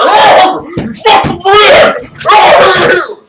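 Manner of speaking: very high, drawn-out screaming; beer-fuelled fan roar. Retold or imitated. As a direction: bellow wildly at the game. Imagined drunk fan at game.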